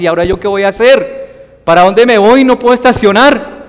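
a rushed driver fretting over parking; worried. Retold or imitated. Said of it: "se preocupaba".

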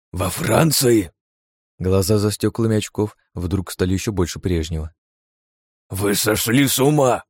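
aghast at the plan; shocked old scientist. A narrator's reading. (потрясённый старый учёный)

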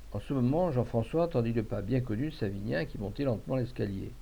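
ordinary delivery, nothing marked

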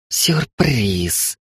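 sinister, gleeful hiss. A narrator's reading. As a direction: hiss it like a villain springing a trap. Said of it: "зловеще прошипела Рокси". (зловещее шипение)

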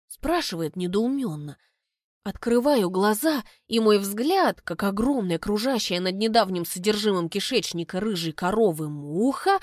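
usual speaking voice

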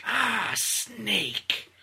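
eerie psychic villain greeting his foe; Psycho Mantis voice. Retold or imitated. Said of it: "Trying to emulate Psycho Mantis".

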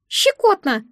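very high, ticklish little squeal. A narrator's reading. The egg is scratched with a nail, squealing. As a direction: squeak it like a tickled tiny creature. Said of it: "взвизгнуло яйцо".

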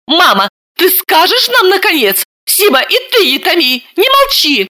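high, exasperated demand; indignant demand. A narrator's reading. Daughter indignantly demanding her mother speak up. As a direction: press them impatiently. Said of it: "Ксения возмутилась".

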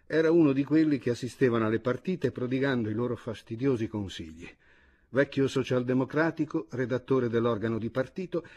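plain reading voice

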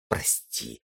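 barely voiced, sulky "sorry"; a sulky sister's whispered apology; grudging mumble. A narrator's reading. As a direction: mumble it under your breath, unwilling. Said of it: "буркнула Света".

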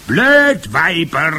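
very high, loud witch howl; furious howl. A narrator's reading. Witch leader scolding her stupid followers, howling. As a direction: wail the insult at full pitch. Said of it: "heulte die Hoch".

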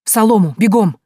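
loud urgent command; barked order. A narrator's reading. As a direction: bark at them to hide. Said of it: "рявкнул Селиван". (Селиван громко рявкает приказ)

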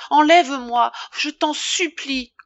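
loud, high desperate cry. A narrator's reading. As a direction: Emma crying out to Rodolphe, desperate. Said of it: "s'écria-t-elle".

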